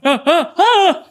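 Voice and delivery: high loud laughing yelps; mock a scared man's squeal; imitated yelp. Mocking the startled rider, high yelps. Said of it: "when men yelp like that".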